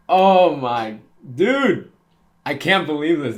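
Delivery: high, loud and thrilled; excited shout. Real, not acted. Fan shouting at the reunion scene, real excited yell. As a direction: yell out the disbelief at the top of your voice.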